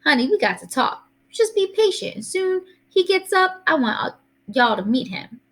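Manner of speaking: high granny voice, coaxing patience; placating Southern grandma. Acted. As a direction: put the daughter off in a high old-lady lilt.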